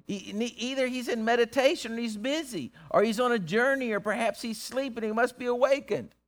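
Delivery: high-pitched sarcastic guessing; needling mockery. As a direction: needle the rivals with each excuse. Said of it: "he's just needling them".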